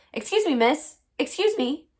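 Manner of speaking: put-on man calling after a girl to stop her. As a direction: catch her attention across the street.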